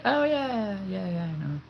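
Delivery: high, breezy oh yeah I know; mock knowing agreement. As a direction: brush it off like you already knew. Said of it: "I'm gonna be like".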